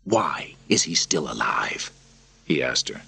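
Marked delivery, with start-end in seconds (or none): 0.1-1.9 slow, cold whispered question; threatening hushed question; menacing whisper. Acted. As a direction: hiss it without voice.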